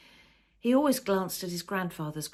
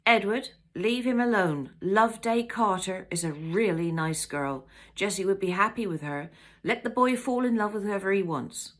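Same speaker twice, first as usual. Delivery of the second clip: louder, pleading mother; raised voice; 3 semitones higher, 6 dB louder than usual. Acted. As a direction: stand up for the son, louder.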